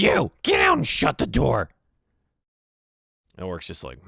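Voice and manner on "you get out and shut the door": high, loud goblin yell; shouted goblin command, pitched high; barking goblin boss. Acted. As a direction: screech an order to get out. Goblin boss orders orc out.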